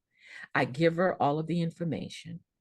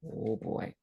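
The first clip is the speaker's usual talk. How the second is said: gentle sigh; 5 semitones lower, 8 dB quieter than usual. Retold or imitated. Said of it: "her eyes got even more gentle".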